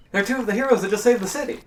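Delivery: high, loud and fast proud announcement; excited exclamation. Acted. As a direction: blurt it out like a thrilled guard.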